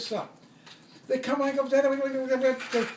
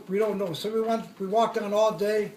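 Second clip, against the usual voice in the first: usual speaking voice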